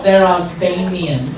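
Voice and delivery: put-on low, slow Russian accent. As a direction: imitate an offended Russian boyfriend. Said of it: "shouting back in his Russian accent".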